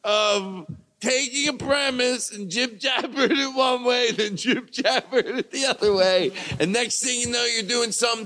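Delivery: high, grand award speech; mock acceptance speech. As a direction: thank the crowd like a winner.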